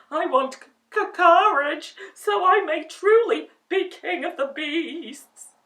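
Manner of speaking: scared stammer. A narrator's reading. Lion stammers to the ball of fire. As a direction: stammer it in fear. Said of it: "stammered the lion".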